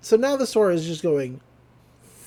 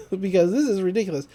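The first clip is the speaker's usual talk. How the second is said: usual speaking voice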